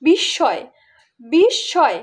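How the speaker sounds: "বিস্ময়"-এ শ দুইবার, "বিশ্-শয়"; দ্বিত্ব উচ্চারণ. Shown as a demonstration. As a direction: say "বিস্ময়" as bish-shoy, with a doubled sh and no m. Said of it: "দুইবার উচ্চারণ করি". (ম-এর জায়গায় শ, "বিশ্শয়")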